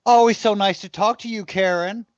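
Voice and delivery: mocking. Mocking echo of host's line.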